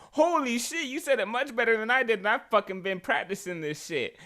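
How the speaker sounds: high, loud retold astonishment; amazed outburst. Teacher retelling being outdone by a student, amazed outburst. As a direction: blurt it out high, in mock disbelief.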